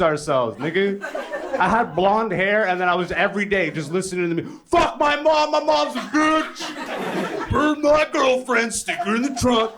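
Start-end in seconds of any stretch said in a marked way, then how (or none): 4.7-9.7 high, drawn-out mock rap yell. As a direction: exaggerate an angry rapper, high and loud. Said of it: "exactly how he sounded".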